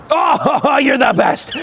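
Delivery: high, laughing praise for a pet; talking to a dog. Real, not acted. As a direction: gush over the dog.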